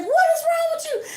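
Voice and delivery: panicked high yelp; frightened high cry. Retold or imitated. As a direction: cry out in alarm. Friend screaming at the window.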